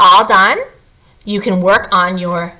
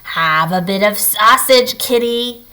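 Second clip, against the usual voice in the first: lightly voiced mocking troll, teasing; troll screech. Little troll taunting the sleeping bear, light mock screech. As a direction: give the little troll a sly teasing edge.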